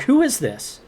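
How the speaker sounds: retold sharp challenge to a caller; demanding who it is. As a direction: fire "Who is this?" at the silent caller. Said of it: "I'm like".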